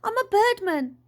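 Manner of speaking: high-pitched, insistent retort; snapping. A narrator's reading. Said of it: "snapped Dad".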